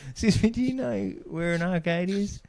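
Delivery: put-on high, small child's voice, polite; young Xavier's voice. Retelling his kid politely asking a stranger, high child voice. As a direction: pitch way up like a little kid asking an adult.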